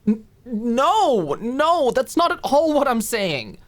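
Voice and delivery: high, rushed flustered protest; flustered denial. Acted. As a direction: insist it's not what you mean, flustered. Alicia denies flustered.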